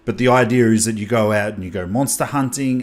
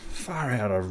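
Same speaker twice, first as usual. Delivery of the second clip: ordinary delivery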